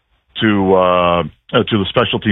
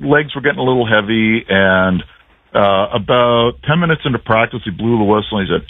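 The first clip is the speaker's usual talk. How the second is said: ordinary talk, nothing marked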